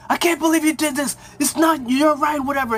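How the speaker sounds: high-pitched mock yell; retold yelling. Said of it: "he's yelling at him".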